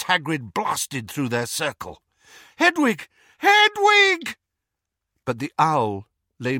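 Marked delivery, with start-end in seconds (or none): 2.6-4.4 anguished denial. Acted. Calling to dead owl.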